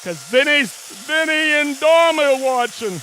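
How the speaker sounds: loud, high, thrilled calling out; buzzing with excitement, can't hold it in; real excited shout. Real, not acted. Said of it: "I got all excited there".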